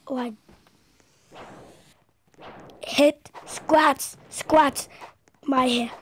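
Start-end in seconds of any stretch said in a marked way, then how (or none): 2.8-5.9 loud, frantic game yells; excited yelling. Real, not acted. Kid yelling while dodging in the game. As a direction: shout it out mid-fight.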